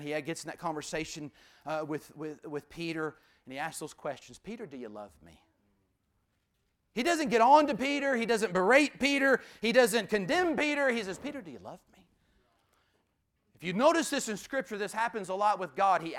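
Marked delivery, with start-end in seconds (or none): 4.4-5.4 tender. Retold or imitated. Jesus asking Peter gently. 11.2-12.0 tender. Retold or imitated. Jesus asking Peter again.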